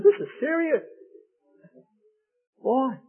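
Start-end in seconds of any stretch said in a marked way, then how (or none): 0.0-0.8 mock serious. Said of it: "I said".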